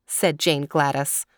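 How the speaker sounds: usual speaking voice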